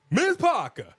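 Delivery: high "Miss Parker" falling off; teasing call. Pretend. Teasing Jada Parker, high falling call. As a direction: sing out her name teasingly. Calling her name again.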